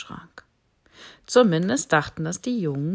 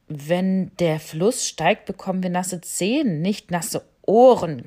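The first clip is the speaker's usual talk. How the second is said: growling lion cub; 7 dB louder than usual. Acted. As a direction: growl the correction at the bat. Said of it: "knurrte das Löwenjunge". (knurrendes Löwenjunges)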